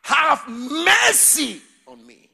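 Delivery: very high, loud, slow shout; blind men crying out. Acted. Blind men shouting to Jesus, high and loud. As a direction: wail it like the desperate blind men. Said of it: "These two blind men cried".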